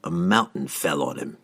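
calm killer stating a fact; cold assassin. A narrator's reading. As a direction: assassin telling the mage coldly. Entreri answers Sidney.

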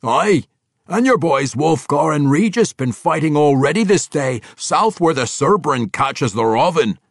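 loud, high, boisterous dwarf bellow; a hearty dwarf calling out in delight; gruff dwarf. Acted. Boisterous dwarf Athrogate greeting his king, loud and gleeful.

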